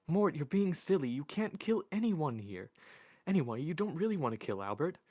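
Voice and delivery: high-pitched female character, agitated; anxious remonstrating, starting loud; pleading. A narrator's reading. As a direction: reason with him urgently in a high voice. Isabel pleads with Mort.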